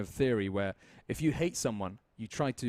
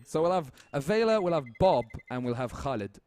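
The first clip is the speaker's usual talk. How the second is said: Arabic accent; 3 semitones higher, 5 dB louder than usual. Shown as a demonstration. Commentator tries his Arabic accent on the player names. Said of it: "I'll try that as well".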